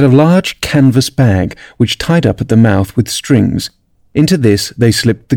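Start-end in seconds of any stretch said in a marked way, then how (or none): none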